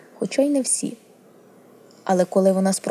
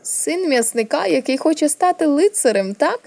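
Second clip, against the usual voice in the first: mocking taunt; 8 semitones higher, 4 dB louder than usual. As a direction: taunt the boy with exaggerated, rising interest. Prince taunts the butcher's boy.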